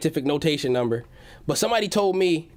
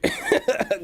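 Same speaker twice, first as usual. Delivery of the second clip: laughing. Real, not acted.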